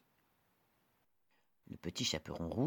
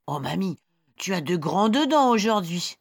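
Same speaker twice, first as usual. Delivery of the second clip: put-on high, bright little girl; 11 semitones higher, 10 dB louder than usual. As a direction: play the small girl. Girl questions granny.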